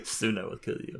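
hushed, low menacing threat; sinister whisper. Acted.

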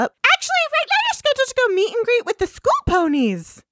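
high pony voice. Acted. Voicing Svengali in episode recap.

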